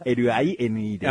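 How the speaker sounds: エルアイエヌイー spelled letter by letter; spelling it out. Shown as a demonstration. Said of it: "アルファベットで言うと".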